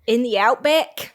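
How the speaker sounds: convincing put-on Aussie accent; mock Australian accent. Shown as a demonstration. Trying the accent for co-host, mock Australian. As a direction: play up the Australian vowels. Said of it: "Wait, that was good".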